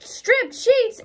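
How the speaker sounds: high, loud shocked cry; a dismayed housewife's startled shriek; shocked exclamation. A narrator's reading. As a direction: blurt it out in horror. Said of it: "exclaimed Missus Rogers".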